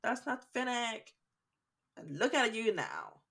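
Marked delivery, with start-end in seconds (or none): 0.0-1.1 higher-pitched imitation of disgusted fans; mock disgust. Fans doubting a casting choice, mock disgust. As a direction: whine it in mock rejection, pitched up.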